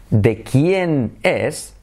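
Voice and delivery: "de quién es" drawn out very slowly; careful, spaced-out teaching delivery; slow and deliberate. Shown as a demonstration. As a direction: stretch each word of "de quién es" apart. Slow model phrase, later said fast.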